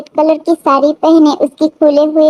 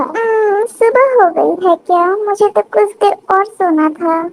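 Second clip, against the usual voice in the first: put-on higher-pitched young wife's waking voice; 4 semitones higher than usual. New bride talks to herself on first morning, waking up. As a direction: voice a just-woken bride talking to herself.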